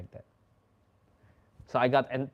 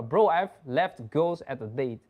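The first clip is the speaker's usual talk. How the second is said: ordinary delivery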